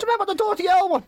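high-pitched prodding question; insistent fan. Retold or imitated. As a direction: imitate a fan pressing for recognition. Fan badgering girlfriend on phone.

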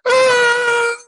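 high-pitched re-enacted scream; long shrieking "ah" in falsetto. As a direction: scream way up high in mock panic. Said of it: "I just started screaming too".

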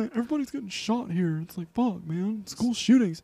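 hammed-up high lament; mock complaining American. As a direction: imitate someone moaning in distress.

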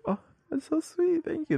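squeaky high, sugary thank-you; high sweet thanks. Retold or imitated. Thanking people who say her name in Spanish, squeaky high. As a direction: chirp it up high like a flattered girl. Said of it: "I'm like".

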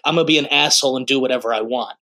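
lightly voiced bratty boast; mock selfish emperor. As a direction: rattle it off like a spoiled emperor. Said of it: "is like".

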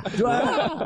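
high, laughing "Dwa" with an exclamation; gleeful high echo of "Dwa"; exclaimed DWA. Shown as a demonstration. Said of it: "With the exclamation".

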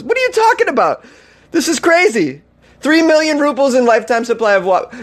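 high, disbelieving cry of "what are you talking about"; stunned high-pitched outburst; incredulous. Real, not acted.

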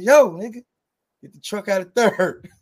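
high, loud playful jab; loud teasing call. Retold or imitated. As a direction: call him out laughing. Teasing loud friend awake.

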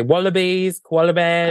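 high put-on Australian listing; mock Australian accent. Australians list what they are famous for, mock Australian accent. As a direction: list them in a lifted fake Aussie voice. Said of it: "They say".

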